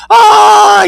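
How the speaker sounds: high, loud, drawn-out scream; long scream. A narrator's reading. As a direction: scream as if being carried away. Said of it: "he screamed as he faded from sight".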